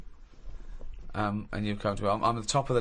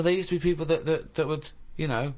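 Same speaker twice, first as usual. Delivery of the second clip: usual speaking voice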